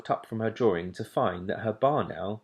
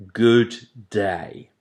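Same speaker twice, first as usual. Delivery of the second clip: dismissive father owl; 8 dB louder than usual. A narrator's reading.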